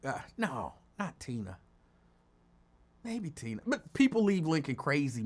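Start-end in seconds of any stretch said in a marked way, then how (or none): none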